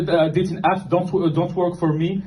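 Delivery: normal talking voice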